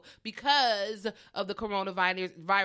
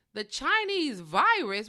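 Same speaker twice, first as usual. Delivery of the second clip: slow, louder, disbelieving echo; incredulous repeat; 6 semitones higher, 8 dB louder, slower than usual. Real, not acted. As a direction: throw the phrase back in disbelief. Outraged at the president's words.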